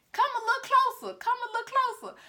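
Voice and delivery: put-on high, pleading old man calling him closer; suspicious old father.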